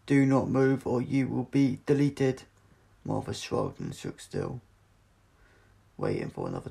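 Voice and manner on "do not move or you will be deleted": loud, flat, robotic command; booming metallic voice. Acted. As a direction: boom it out like a machine. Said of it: "A metallic voice boomed".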